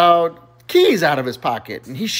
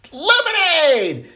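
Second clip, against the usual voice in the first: high, long-drawn acted scream; screamed order; 14 semitones higher, much slower than usual. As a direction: scream it out while sliding down. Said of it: "scream out the beverage of your choice".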